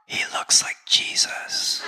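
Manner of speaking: slow, amused whisper. Retold or imitated. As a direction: lean in and whisper it. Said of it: "whispers in my mother's ear".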